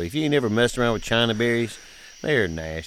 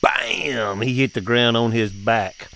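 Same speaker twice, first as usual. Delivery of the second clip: ordinary talk, nothing marked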